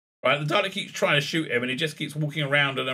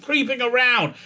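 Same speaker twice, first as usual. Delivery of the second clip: losing it; 10 semitones higher than usual. Retold or imitated. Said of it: "kinda loses it".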